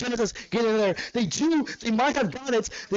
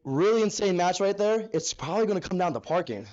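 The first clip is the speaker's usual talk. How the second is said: normal talking voice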